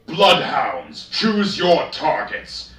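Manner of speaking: high, loud, slow battle command; shouting orders. A narrator's reading. Sergeant Darkseer rallying his Bloodhounds to fire, roaring command. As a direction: bellow the order to open fire.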